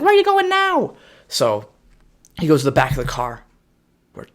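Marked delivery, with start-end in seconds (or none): 0.0-0.9 mock know-it-all kid. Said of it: "He's like".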